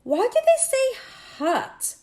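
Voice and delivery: put-on wide-eyed puzzled child asking; high, curious young boy. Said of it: "asked Tom".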